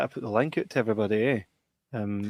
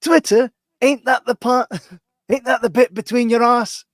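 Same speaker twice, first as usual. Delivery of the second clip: very high, loud put-on voice, cracking with laughter; mimicking a mate's outraged high-pitched query; Henry's voice; 12 semitones higher, 8 dB louder than usual. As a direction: squeal it out like a scandalised bloke. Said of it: "That's how he spoke".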